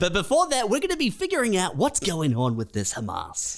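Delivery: fast, chirpy breakfast TV host patter; morning show host. Retold or imitated. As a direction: rattle it off like a cheerful morning host. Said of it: "We're like".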